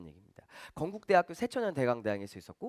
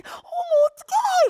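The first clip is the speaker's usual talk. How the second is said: imitating a shrill, much higher, louder squeal; mock swooning female fans squealing; hammed-up fan squeal, way up high; 14 dB louder than usual. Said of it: "이럽니다".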